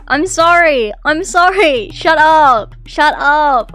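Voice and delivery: flustered loud outburst; exasperated shouting. Real, not acted. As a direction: shout down the hecklers. Shouting back at teasing chat.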